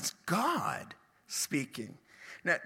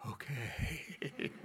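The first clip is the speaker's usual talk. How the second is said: soft laughing reply; laughing okay; 4 semitones lower, 10 dB quieter than usual. Retold or imitated. Matthew answering Jesus, soft and laughing. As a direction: chuckle the word out quietly.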